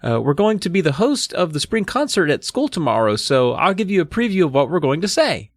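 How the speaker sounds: polite little presenter; lightly voiced girl. A narrator's reading. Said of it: "she told the Millers".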